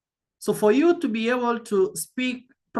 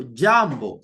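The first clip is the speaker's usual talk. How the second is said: jambo with a strong JAM, low falling BO; jambo, JAM emphasized; stress on first syllable; 7 semitones lower than usual. Shown as a demonstration. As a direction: punch JAM in jambo, drop BO. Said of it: "So there's a stress on".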